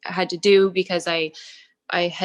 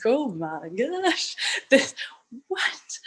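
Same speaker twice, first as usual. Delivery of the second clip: slow, breathless laugh of shock; laughing disbelief; 4 semitones higher, much slower than usual. Retold or imitated. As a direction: laugh it out, stunned. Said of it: "I'm like".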